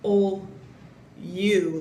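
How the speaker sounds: O, then U said as "yu" with a Y; O and U apart, Y sound starting the second; broken into sounds. Shown as a demonstration. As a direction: split the word into "ol" and "yu". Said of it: "like Y".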